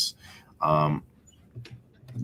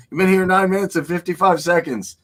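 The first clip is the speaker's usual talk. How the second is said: a mock officious timekeeper, higher and louder; stopwatch guard; 12 semitones higher, 7 dB louder than usual. Mock timekeeper calling out the time to a visitor, officious. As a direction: ham up a fussy official counting seconds. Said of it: "a guy with a stopwatch".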